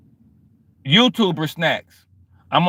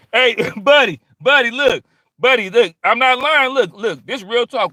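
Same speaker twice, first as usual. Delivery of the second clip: excited laughing call; 7 semitones higher, 5 dB louder than usual. Real, not acted. Calling out, laughing, insisting.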